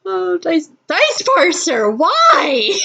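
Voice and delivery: high, drawn-out mock despair at the dice; mock lament. Player blames the dice parser, mock lament. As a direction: plead in hammed-up dismay.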